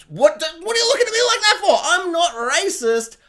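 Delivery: high, fast, indignant protest, then slowing; mock defensive. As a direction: play the caught-out bigot, squeaky and offended. Mock-defensive Targaryen persona.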